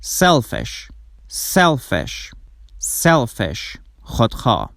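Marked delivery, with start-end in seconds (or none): none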